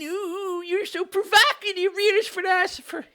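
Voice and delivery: gushing in a silly high voice; mock fawning. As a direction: put on a high, mocking coo. Mock voice of praise.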